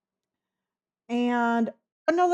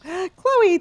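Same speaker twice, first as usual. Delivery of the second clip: gasp, then her name pitched up high and louder; surprised gasp; 9 semitones higher, 5 dB louder than usual. Real, not acted. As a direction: caught off guard by a gift, gasp the name out. Gasps at a gift from Chloe.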